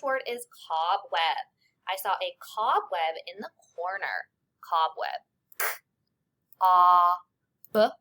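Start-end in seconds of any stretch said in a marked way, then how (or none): 5.6-7.9 "K, O, B" as bare sounds with pauses; phoneme by phoneme. Shown as a demonstration. Teacher segmenting first syllable, sound by sound. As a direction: sound out cob, the middle vowel held and loud. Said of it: "Cob is the first syllable".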